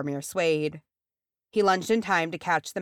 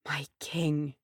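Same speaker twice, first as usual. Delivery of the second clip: whispered last breath, softer; 5 dB quieter than usual. A narrator's reading. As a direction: breathe it out as a dying man. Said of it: "and he breathed".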